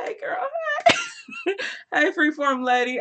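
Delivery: squealed high greeting; excited hello. Real, not acted. Welcoming a viewer, high squealed greeting.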